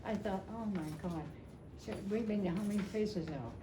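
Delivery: ordinary talk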